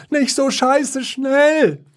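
very high, slow wailing whine; whining. A narrator's reading. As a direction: wail it slowly up high. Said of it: "jammerte Klops". (sehr hohes, langgezogenes Jammern)

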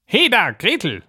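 high-pitched witch's summons; witch calling out. Acted. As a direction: call the girl over in a high witch voice. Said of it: "rief sie dem Mädchen zu". (herbeirufende Hexenstimme)

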